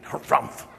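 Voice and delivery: huffy breathy harrumph snort; a voiceless indignant huff. Shown as a demonstration. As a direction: snort it out like an offended old man. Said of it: "when I'm indignant, I'm indignant".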